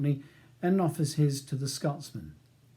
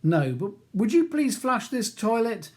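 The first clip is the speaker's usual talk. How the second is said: calling out; 9 semitones higher, 6 dB louder than usual. A narrator's reading. Calling through the door.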